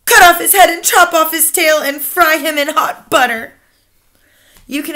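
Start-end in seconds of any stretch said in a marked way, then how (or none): 0.1-3.5 higher, louder greedy witch voice; gloating witch crowd. Acted. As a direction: relish the cruelty.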